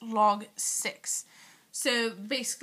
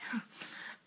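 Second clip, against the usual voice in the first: whispered giggle; 12 dB quieter than usual. Real, not acted. Giggle after whispered aside.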